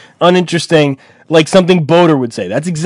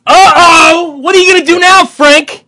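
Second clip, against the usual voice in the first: mock taunting voice; 11 semitones higher, 6 dB louder than usual. Host stirring up on-air beef.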